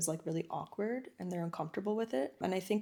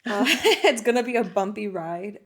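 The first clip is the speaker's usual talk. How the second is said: laughing; 3 semitones higher, 10 dB louder than usual. Real, not acted. Laughing while warning past self.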